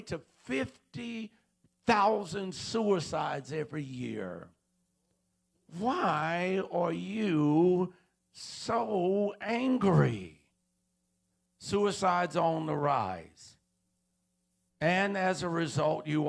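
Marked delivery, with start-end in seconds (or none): 5.8-10.4 very slow, louder, stretching so angry; loud drawn-out question. Acted. Preacher demanding of the audience after the statistics, loud and drawn out. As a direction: hammer the question home.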